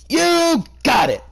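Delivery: a high, loud, over-eager cry; eager cheer. Acted. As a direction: belt it out like a cheering friend. Character voiced in a read-aloud story.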